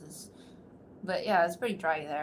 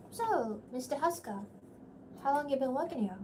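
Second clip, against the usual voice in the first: put-on Celia's accent; 7 semitones higher than usual. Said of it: "I actually have to remember she has an accent".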